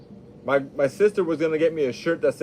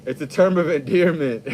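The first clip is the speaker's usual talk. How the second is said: ordinary delivery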